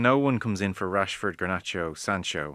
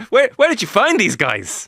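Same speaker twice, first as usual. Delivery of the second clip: high, louder mock-incredulous question; mock amazement; 11 semitones higher, 5 dB louder than usual. Cohost mocking the obvious signings, high mock amazement. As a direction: play at being amazed by such well-known signings.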